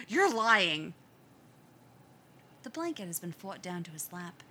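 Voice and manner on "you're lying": put-on boy refusing to believe his brother; insistent. A narrator's reading. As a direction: dig in and insist. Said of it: "Jay insists".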